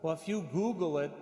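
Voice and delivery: ordinary delivery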